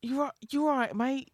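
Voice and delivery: high, overdone meek British "mate"; mock British accent. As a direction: imitate the actor's feeble British accent. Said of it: "It's that".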